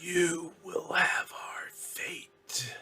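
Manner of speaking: slow, ghostly whispered warning; faint, haunting whisper of doom; eerie whispering voice. Acted. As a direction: stretch each word out in an ominous whisper. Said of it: "things like".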